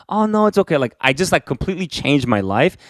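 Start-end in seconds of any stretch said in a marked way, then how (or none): 0.1-0.8 high, breezy brush-off; brushing friends off. Retold or imitated. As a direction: wave their worry away lightly. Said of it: "I'm just like".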